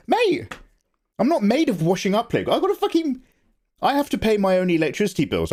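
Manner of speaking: high indignant outburst; mock indignation. Real, not acted. Streamer protests to chat about bills, high mock indignant. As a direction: protest it, voice jumping up.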